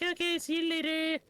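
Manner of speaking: very high, loud falsetto goodbye; mocking the aunt's cheerful sign-off; mock sing-song goodbye. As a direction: chirp it in a silly high voice.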